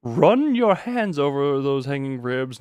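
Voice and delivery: normal talking voice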